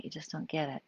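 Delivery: soft, quiet weary complaint; quiet reproach. Retold or imitated. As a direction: say it softly, fed up.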